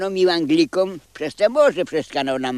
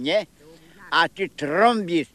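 normal talking voice

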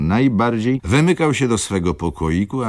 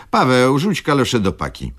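louder, higher command; brisk order; 8 semitones higher, 4 dB louder than usual. Acted. Clerk orders servant to toss old galoshes, louder.

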